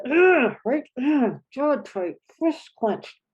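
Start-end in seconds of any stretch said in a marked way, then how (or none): 0.1-0.6 sharp high angry "ugh" from the gut; angry grunt. Shown as a demonstration. Teacher showing the sound of anger: angry grunt. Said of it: "that mammalian". 1.0-1.4 short tense "ugh", jaw tight; angry grunt. Shown as a demonstration. Teacher showing the sound of anger: tight grunt. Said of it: "that mammalian".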